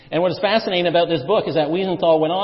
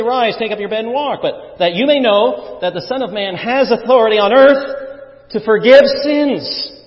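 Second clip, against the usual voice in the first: raised, emphatic preaching voice as Jesus; Jesus rebuking; 5 semitones higher, 6 dB louder than usual. Acted. Jesus answering the questioning scribes, firm and raised. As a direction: challenge them firmly and lift the questions. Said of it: "said to them".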